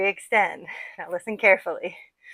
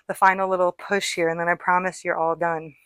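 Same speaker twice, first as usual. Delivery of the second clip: ordinary talk, nothing marked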